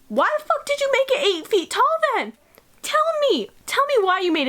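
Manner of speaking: high-pitched exasperated demand; mock outrage. Reader grills Victor over the eight-foot monster, outraged and high. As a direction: demand answers with pitch shooting up.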